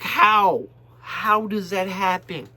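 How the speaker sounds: mock disbelief at the band; incredulous quote. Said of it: "And I'm like".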